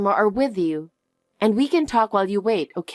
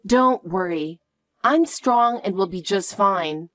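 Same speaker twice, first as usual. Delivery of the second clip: put-on high childlike voice, anxious for Mom; little girl fearing for her mother; worried young girl; 7 semitones higher than usual. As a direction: ask like a kid seeking reassurance.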